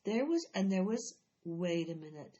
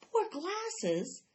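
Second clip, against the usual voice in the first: higher, incredulous echo; surprised; 9 semitones higher than usual. Acted. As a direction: repeat it like you can't believe it. Jessie echoes boy in disbelief.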